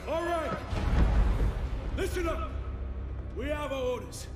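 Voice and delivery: rally the troops at full shout; drill shout. Acted. Officer briefing the troops on orders, shouting.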